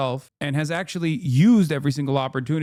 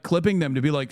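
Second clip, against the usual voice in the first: normal talking voice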